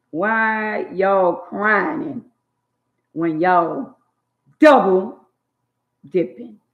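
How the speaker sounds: "Why y'all crying" drawn out very slowly, "double" loud; slow, stressed scolding. Real, not acted. Scolding women for double dipping, slow and pointed. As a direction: spell it out word by word.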